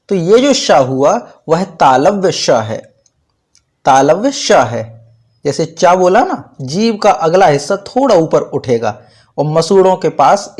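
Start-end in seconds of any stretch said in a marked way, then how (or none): none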